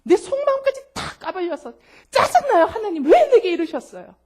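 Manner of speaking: high, loud exasperated whine; frank complaint. Retold or imitated. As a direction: vent to God like a fed-up child. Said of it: "솔직하게 이야기할 때".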